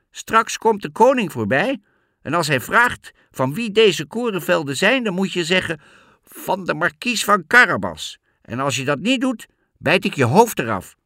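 high, loud, bossy cat; threatening cat. A narrator's reading. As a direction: order the farmers about, then threaten them. (hoge, luide, dreigende kat)

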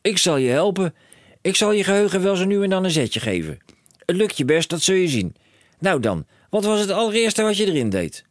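high and loud, racing with plans; a dad who sees a fortune; excited cry. A narrator's reading. Father telling his son of riches, high excited call.

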